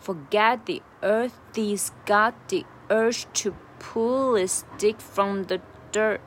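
slowly drawn-out phrase; slow linked take. Shown as a demonstration. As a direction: link it all up slowly, leaning on the beats. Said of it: "慢速的来一下".

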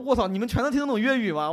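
loud, high panicked swear; panicked outburst. Retold or imitated. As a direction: blurt it out in alarm. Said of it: "我当时就慌了".

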